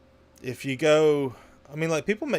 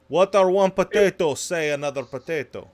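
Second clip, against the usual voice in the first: a light Russian accent on the joke, articles dropped; lightly voiced Russian accent, deadpan; 3 dB louder than usual. Shown as a demonstration. Retelling the potato joke to friends in a light Russian accent. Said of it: "say it with a Russian accent".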